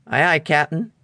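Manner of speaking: nervous first mate asking anxiously; Smee voice. A narrator's reading.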